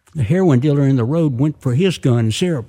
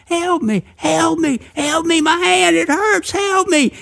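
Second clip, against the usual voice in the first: very high-pitched pleading cries; hollering for help. A narrator's reading. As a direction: act out a wounded man hollering. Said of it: "Somebody was hollering".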